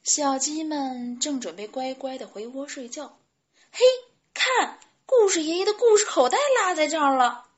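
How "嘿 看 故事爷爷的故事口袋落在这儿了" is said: high, loud, excited chick call; shouting. A narrator's reading. Chick spotting grandpa's forgotten pocket, excited shout. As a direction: call everyone over to look. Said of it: "卡门喊道".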